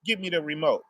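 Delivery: low, quiet and matter-of-fact; mother asking for the remote. Retold or imitated. Mom calling her kid over just to say it, low and quiet. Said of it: "she just like".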